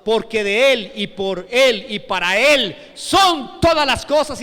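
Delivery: loud, declaimed scripture; fervent preaching. Acted. Preacher reciting Pablo's praise of God, fervent proclamation. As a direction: proclaim each phrase with full-voiced wonder.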